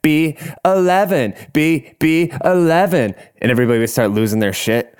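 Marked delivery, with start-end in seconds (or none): 0.0-3.1 higher, hammed-up drag host bingo call; bingo hosts' call. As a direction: imitate hosts milking each syllable. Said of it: "they'd be like".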